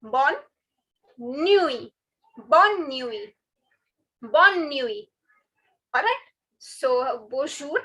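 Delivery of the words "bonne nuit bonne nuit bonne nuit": bonne nuit with nuit drawn out to ee; silent final T. Shown as a demonstration. Teacher drilling good night, T left silent. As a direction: say "nuit" as nwee after "bonne". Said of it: "Don't pronounce it".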